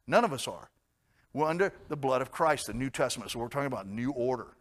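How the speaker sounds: ordinary talk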